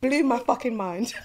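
ordinary talk

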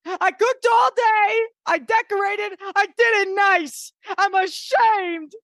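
high, hammed-up hostess meltdown; Dorinda. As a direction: rant like an outraged, wounded hostess. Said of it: "if you need a Dorinda impressionist".

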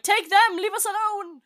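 high-pitched theatrical cry; mock panicked plea. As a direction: cry out as if throwing others to danger. Acting out panicked betrayal.

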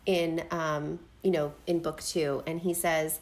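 ordinary talk

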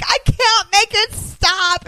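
put-on high, slow whimpering kid; whiny crying girl. Crying girl answering the princess, high and whiny. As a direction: whine it out through tears. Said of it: "She's like".

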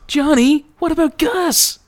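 very high, squeaky imploring voice; hammed-up desperate plea. Nerdy dude begging Johnny as he leaves, desperate mock plea. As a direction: call after him in an exaggerated falsetto plea.

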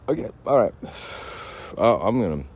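normal talking voice

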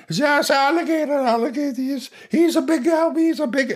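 put-on a drunk lizard man hanging off his brother. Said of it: "the other brother is constantly drunk".

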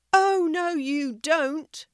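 high, drawn-out protest; shouted back. A narrator's reading. Animals contradicting the lion, high mild shout. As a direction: call it back high and defiant. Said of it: "shouted the animals back at him".

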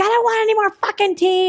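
high-pitched retold outburst; hammed-up panicked protest; freaking out. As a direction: imitate a frantic refusal, pitch way up. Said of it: "she's freaking out".

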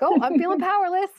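high, loud whiny complaint; mock whine. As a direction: whine it like a frustrated spouse. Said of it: "I've caught myself whining to my husband".

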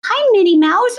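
put-on high, small-child greeting; high-pitched little kid saying hi; little girl voice. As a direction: imitate a shy little daughter in a high pitch. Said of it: "in her little voice".

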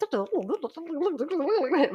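high, wobbling wordless babble; mock mental chatter. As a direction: imitate a racing mind with rolling gibberish. Imitates chatter in own head.

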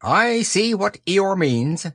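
high, slow owl voice; measured, high-pitched owl. Acted. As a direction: draw it out high and self-important. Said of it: "said Owl".

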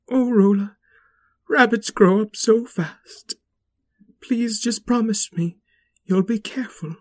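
put-on a mother begging her child; worried mother. Mother's tearful goodbye.

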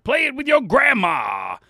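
high, loud promo shout; over-the-top shouted ad read; yelling. Real, not acted. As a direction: yell the plug for no reason. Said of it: "I don't know why I'm yelling".

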